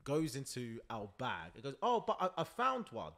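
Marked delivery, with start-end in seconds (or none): 1.8-3.1 put-on higher-pitched little kid voice; lightly imitated excited kid; voiced child. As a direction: play the innocent child piping up. Retelling an innocent child's protest.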